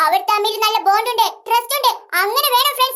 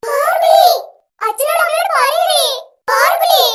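higher, louder calls to the parents; loud threat; 4 semitones higher, 6 dB louder than usual. Acted. Threatening to spoil the surprise party, loud calling. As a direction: threaten to shout for mum and dad.

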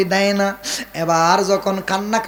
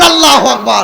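loud, raised cry to the crowd; rallying takbir; 4 semitones higher, 6 dB louder than usual. Shown as a demonstration. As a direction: lead the congregation in a shout. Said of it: "হাত তুলে বলেন". (জোর গলায় তাকবীর)

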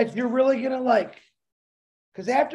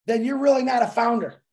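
normal talking voice

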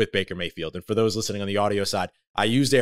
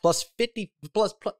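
botched odds, quickly read; stumbling over the figure; misread number; 10 semitones higher than usual. Real, not acted. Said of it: "let me try that again".

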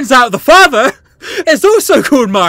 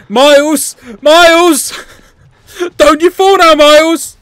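loud, raised warning shout; urgent calling out; 4 semitones higher, 4 dB louder than usual. Real, not acted. Player to Miles as he climbs, urgent shout. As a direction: call out to stop him falling.

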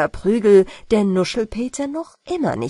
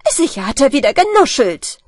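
loud, fast scolding; annoyed mother; 5 semitones higher, 8 dB louder, faster than usual. Acted. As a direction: rattle off the complaint. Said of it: "sagt die Mutter".

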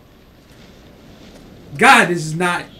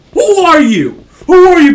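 high, loud outraged scream; real outraged yell; furious shout; 9 semitones higher, 14 dB louder than usual. Real, not acted. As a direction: shriek it at the game. Yelling at the game.

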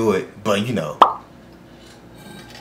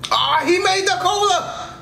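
much higher and slow, overjoyed; delighted; 13 semitones higher, slower than usual. Real, not acted. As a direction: gush with delight. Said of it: "I love Senku".

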